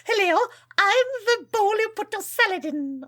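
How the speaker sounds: put-on high, wavering old lady voice. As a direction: play a fussy old woman. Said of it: "yet another version of your old lady voice".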